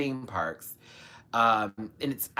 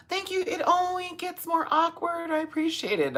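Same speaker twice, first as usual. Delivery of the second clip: grateful. Real, not acted. Thanking a donor.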